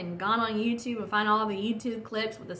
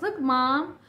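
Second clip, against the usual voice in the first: lightly voiced kid, higher and louder; imitating excited son; 4 semitones higher, 5 dB louder than usual. Young son showing mom his Kindle, imitated eager child. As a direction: call mom over like an eager little boy, lightly imitated.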